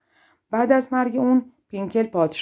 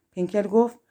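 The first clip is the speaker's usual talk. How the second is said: ordinary delivery, nothing marked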